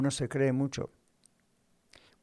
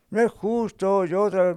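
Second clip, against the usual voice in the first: higher, louder aggrieved protest; put-upon complainer, pitched up; mock complaint; 6 semitones higher, 5 dB louder than usual. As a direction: imitate someone protesting unfair treatment. Voices an offended worker's complaint.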